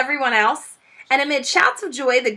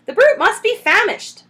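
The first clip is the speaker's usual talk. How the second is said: high, louder sneer; sneering Bunce; 12 semitones higher, 6 dB louder than usual. Acted. Bunce at the foxhole, sneering impatiently.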